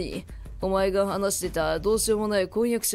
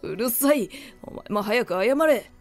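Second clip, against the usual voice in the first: stern scolding; 4 dB louder than usual. A narrator's reading. Father scolds daughter.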